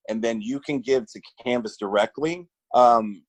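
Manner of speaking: ordinary delivery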